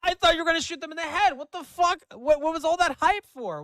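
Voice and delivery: high-pitched, indignant disbelief; incredulous outburst. Real, not acted. Streamer let down after all that hype, incredulous outburst. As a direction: protest loudly at the anticlimax.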